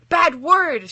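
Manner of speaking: shocked high gasp. A narrator's reading. Little girl gasps at Death's swear, shocked. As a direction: gasp it, scandalised. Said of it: "she gasped".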